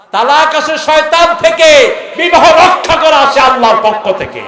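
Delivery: high, loud fiery sermon shout; preaching shout. Real, not acted. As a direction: thunder it from the pulpit. Preaching at full force. (উচ্চস্বরে জ্বালাময়ী ওয়াজ)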